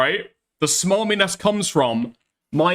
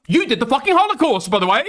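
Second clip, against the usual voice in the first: mock outburst; 7 semitones higher, 3 dB louder than usual. Mock insult to Germans.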